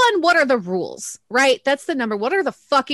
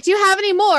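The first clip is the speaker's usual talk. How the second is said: put-on high, loud little kid begging for more; mock kid asking; 10 semitones higher, 5 dB louder, much faster than usual. Podcaster mimicking the snatched kid, high and pleading. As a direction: play the greedy kid asking for candy. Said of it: "is like".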